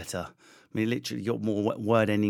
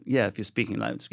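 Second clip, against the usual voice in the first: usual speaking voice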